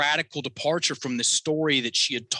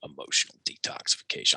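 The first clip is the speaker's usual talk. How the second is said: scoffing dismissal; 10 semitones lower than usual. Retold or imitated. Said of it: "And I'm like".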